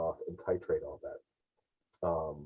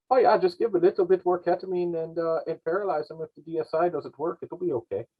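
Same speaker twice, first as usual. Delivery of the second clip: higher-pitched imitation of a Scottish doctor; Scottish accent impression; 10 semitones higher, 3 dB louder than usual. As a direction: mimic the Scottish medical director, cheerful and casual. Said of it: "in that accent".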